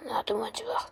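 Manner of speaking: very soft, fading hero; weakened, wounded. Acted. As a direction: answer faintly, badly hurt. Wounded Peter answers Miles.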